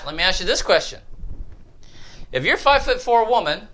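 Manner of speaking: ordinary talk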